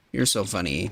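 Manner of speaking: normal talking voice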